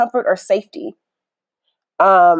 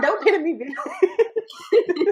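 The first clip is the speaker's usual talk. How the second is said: laughing mock quote; 9 semitones higher, 7 dB quieter, much slower than usual. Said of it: "She's like".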